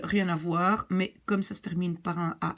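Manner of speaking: ordinary delivery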